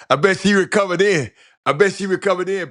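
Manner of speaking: loud, high teasing exclaim; excited outburst. Real, not acted. Teasing caller about chop shop.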